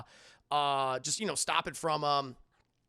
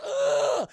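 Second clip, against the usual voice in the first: loud, high yell of pain; sudden mock agony yelp; imitated pain cry; 9 dB louder than usual. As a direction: cry out as if an arm is shattered. Imitates the arm being shattered.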